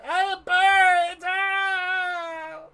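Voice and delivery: high, slow drawn-out wail; screaming in pain. Acted. Burned patrons screaming as they flee. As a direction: howl that you're on fire.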